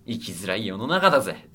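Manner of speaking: usual speaking voice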